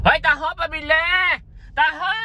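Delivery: shouting taho, pabili; calling out. Real, not acted. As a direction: hail the taho seller down the street.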